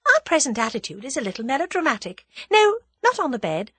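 high, light, politely in control; breezy courtesy with the upper hand; pleasant. A narrator's reading. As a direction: give orders as pleasant suggestions. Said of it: "she said pleasantly".

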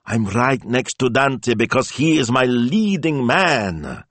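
lightly voiced proud woman; proud diva voice. Antonella voiced as character.

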